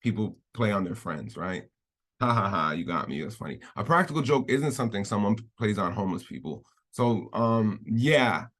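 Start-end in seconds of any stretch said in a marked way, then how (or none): 2.2-3.6 low, half-hearted fake laugh and reply; mock amusement. Host imitating a pranked friend, mock amusement. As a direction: play along lightly with the prank, trailing off softer.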